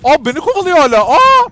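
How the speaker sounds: high, loud frightened cry; being chased. Real, not acted. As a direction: shriek as it keeps coming. Spider still chasing. (kovalanırken korkuyla çığlık)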